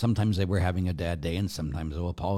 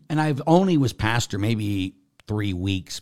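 normal talking voice

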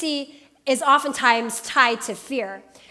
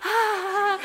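put-on child's gasp; 6 semitones higher than usual. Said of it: "he's just like".

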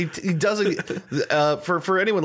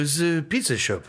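Dalai Lama impression; 3 semitones higher than usual. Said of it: "he's like doing that kind of thing".

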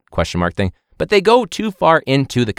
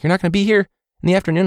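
students' voice; 10 semitones higher, much faster than usual. Retold or imitated. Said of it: "being like".

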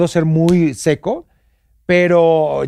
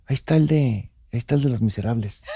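hushed excited whisper; 7 semitones lower, 7 dB quieter than usual. Retold or imitated. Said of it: "la mensa Janet está así, me hace".

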